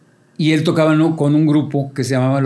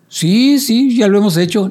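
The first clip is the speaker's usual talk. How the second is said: high, loud "sí, sí"; raised-pitch mock assurance; acted eager assurance; 7 semitones higher, 4 dB louder than usual. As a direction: agree instantly and too confidently. Acted eager reply to the bride.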